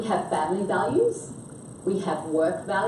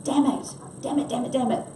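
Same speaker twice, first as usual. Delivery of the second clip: mild frustration; 3 semitones higher than usual. Shown as a demonstration. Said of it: "I can be frustrated".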